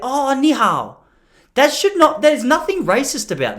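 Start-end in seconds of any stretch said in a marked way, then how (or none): 0.0-1.0 high put-on Chinese greeting; earnest attempt at a Chinese accent, pitched up. Shown as a demonstration. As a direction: mimic Chinese tones to greet a child. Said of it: "communicate with a little Chinese kid in his accent".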